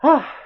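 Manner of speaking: sharp high loud "ah" as the back cracks; back cracking groan. Real, not acted. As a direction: let out a sudden high gasp of relief. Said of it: "my back's cracking".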